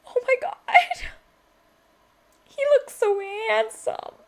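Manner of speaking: very high, tearful mock squeal; hammed-up fangirl whimper; swooning. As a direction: squeak it out slowly, overcome by how gorgeous he is. Acts out own swooning reaction.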